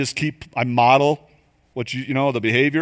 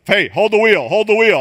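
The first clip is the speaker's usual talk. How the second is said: higher, louder urgent alert; car warning; 7 semitones higher, 6 dB louder than usual. Acted. Car tells the driver to grab hold, urgent warning. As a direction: call out the warning twice, urgently.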